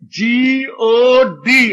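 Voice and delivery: very slow, letter-by-letter जी ओ डी; child spelling out. Acted. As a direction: stretch each letter like a beginning reader. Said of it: "अभी school जाना सीखा है".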